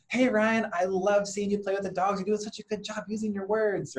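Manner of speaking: put-on a dad running over to praise his little girl, gushing. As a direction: lay on the delight like you're praising a toddler.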